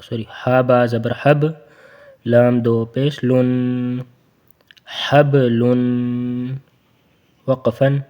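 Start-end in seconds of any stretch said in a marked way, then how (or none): 2.2-6.5 slow, drawn-out حبلن read joined; slow recitation demo. Shown as a demonstration. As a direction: stretch حبلن as one word. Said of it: "ملا پورا پڑھتے ہیں حبلن".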